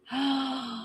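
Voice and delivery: a sharp, soft intake of breath; shocked gasp. Real, not acted. Medium gasping at the murder vision, shocked. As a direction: gasp quietly as the vision lands.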